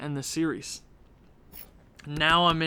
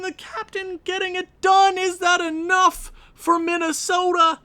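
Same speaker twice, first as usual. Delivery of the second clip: much higher, louder broadcaster voice; excited play-by-play; 12 semitones higher, 6 dB louder than usual. Acted. Gamer calling late goals as an excited announcer.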